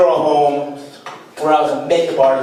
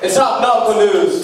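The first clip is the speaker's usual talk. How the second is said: mock news anchor; 5 semitones higher than usual. Mock newscaster segment opener.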